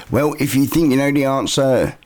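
usual speaking voice

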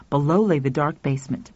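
usual speaking voice

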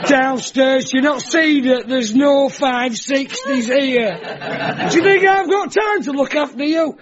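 comic shop-worker rant, rising in pitch; hammed-up indignant telling-off; mock exasperation. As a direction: berate a hapless customer for laughs. Acted exasperated rant at shop customer.

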